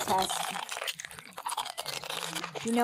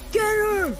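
shouting; 10 semitones higher than usual. Acted. Mob chasing girl.

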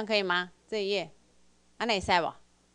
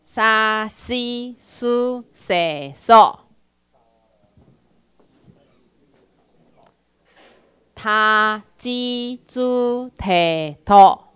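each syllable drawn out, loud and slow; slow loud drill; 10 dB louder, much slower than usual. Shown as a demonstration. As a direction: lead the class in a slow chant. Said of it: "再一遍好不好". (大声慢速带读)

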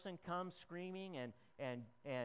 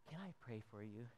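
much softer, lower gentle question; gentle offer; 6 semitones lower, 10 dB quieter than usual. Acted. Visitor offering prayer to a sick patient, soft and gentle. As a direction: ask it tenderly at the bedside.

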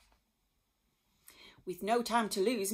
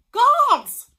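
high, very loud summons; calling out; 21 dB louder than usual. A narrator's reading. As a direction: call the guards across the palace. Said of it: "she called".

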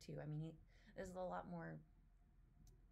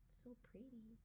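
whisper; 5 semitones higher, 8 dB quieter than usual. Real, not acted. Awed at scenery.

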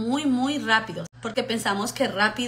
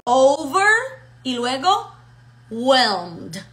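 "Over", a pause, then "whelmed"; teacher's careful word-by-word demo; split in two words; 5 dB louder, much slower than usual. Shown as a demonstration. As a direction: model each half separately, slow and clear. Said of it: "Está dividido en dos palabras".

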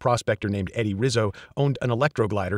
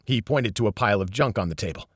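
plain reading voice

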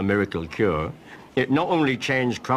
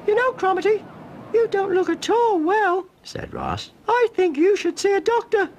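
cried out; 4 dB louder than usual. Acted. Ross crying out at Cromarty's spots, squeaky. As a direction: yelp in shock like a cartoon boy. Ross's boy voice.

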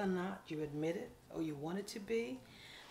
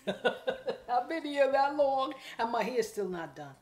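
slow, louder, laughing exaggeration; laughing mock complaint; 6 semitones higher, 9 dB louder, slower than usual. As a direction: ham up the endless wait. Mock customer who waited forever.

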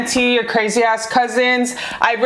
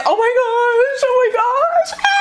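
very high, squealing oh my gosh; shrieking giddy joy; squealing excitement; 14 semitones higher than usual. Real, not acted. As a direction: squeal with pure delight. Ecstatic squeal over the card.

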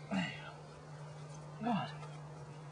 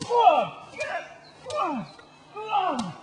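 sharp high shouts urging it on; shouting encouragement; 13 semitones higher, slower than usual. Real, not acted. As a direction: yell at it to get going. Urging something on outdoors.

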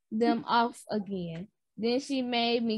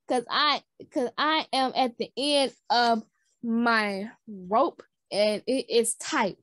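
ordinary delivery

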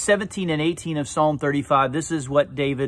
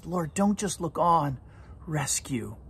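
David's plea; 6 dB quieter than usual. Retold or imitated. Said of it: "And David cries".

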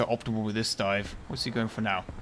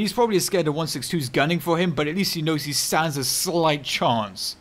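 usual speaking voice

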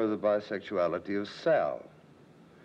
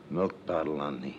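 ordinary talk

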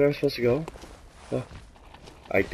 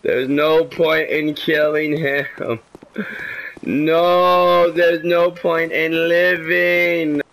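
slow, drawn-out mock lament; mock despair, slow and high; hammed-up despair; 5 semitones higher, 3 dB louder, much slower than usual. Real, not acted. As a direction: wail it like a tragedy. Melodramatic despair after dying.

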